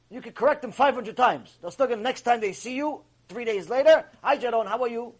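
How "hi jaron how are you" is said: imitated Latin-accented greeting, higher, hard J in "Jaron"; lightly put-on Latin accent, pitched up. Latin person greeting him three days later, Latin accent. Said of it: "That's the accent".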